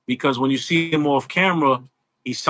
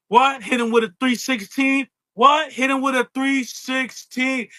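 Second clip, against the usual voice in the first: higher, punchy promo with yelled What; Stone Cold impression; 6 semitones higher than usual. Doing Stone Cold for the host who idolizes him. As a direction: work the crowd like a brash wrestler. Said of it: "It ain't gonna sound like Steve".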